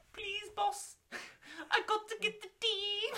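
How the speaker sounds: high, far-off pleading put-on voice; Wayne Rooney impression. Rooney pleads to the mafia boss for money, high and put-on. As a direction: beg the boss in a squeaky mock Rooney voice. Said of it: "my Wayne Rooney impression".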